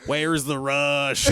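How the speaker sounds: put-on slow Southern-accented tag line; Chuckleberry Finn. Comic landing the joke's tag, slow Southern accent. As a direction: drag the punchline out in Southern. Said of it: "try saying them in a Southern accent".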